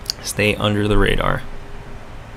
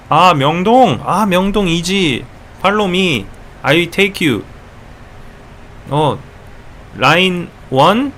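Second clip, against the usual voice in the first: put-on higher, eager Korean-accented English; halting Korean-accented directions to a lost tourist; helpful Korean local's accent; 4 semitones higher than usual. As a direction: play a friendly Seoul local with a Korean accent. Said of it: "people will come up to you and say".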